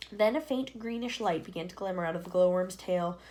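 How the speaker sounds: ordinary delivery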